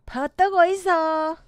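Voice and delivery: Gyeongsang dialect, sustained pitch; 경상도 사투리. Shown as a demonstration. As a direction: urge them in with a Gyeongsang lilt. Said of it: "경상도". (경상도 사투리로 재촉하듯)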